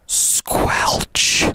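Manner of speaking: whispered wet squelch noise; squelch sound. Retold or imitated. Mimicking the squelch his bare foot made in the shower.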